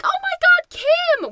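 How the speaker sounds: high, loud delighted shriek; Barbie recognising the grown-up Kim, shrieking; mock surprise. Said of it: "she's like".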